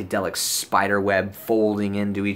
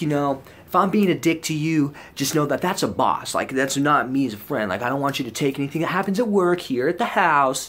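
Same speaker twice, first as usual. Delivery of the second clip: higher-pitched imitation of an earnest buddy; mock-earnest, higher dude voice; rambling roommate; 5 semitones higher than usual. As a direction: voice a tripping man's over-explaining friend.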